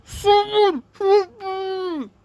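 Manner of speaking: high, slow, crying plea; hammed-up panicked scream for help; pretend cry for help. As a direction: wail for rescue in mock fear. Character cries for help.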